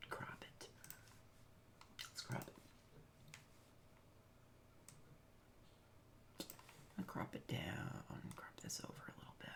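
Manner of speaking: whispering to yourself. Real, not acted. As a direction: mutter it under your breath. Muttering while editing.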